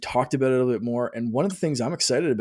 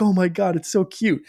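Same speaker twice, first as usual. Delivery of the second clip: gushing over something cute; 7 semitones higher, 3 dB louder than usual. Retold or imitated. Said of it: "be like".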